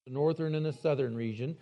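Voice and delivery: usual speaking voice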